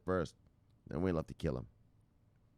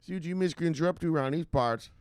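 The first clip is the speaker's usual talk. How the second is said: high, punchy mock-outlaw challenge; imitated tough woman; 7 semitones higher than usual. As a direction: call them out in a raised, cocky voice. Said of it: "She said".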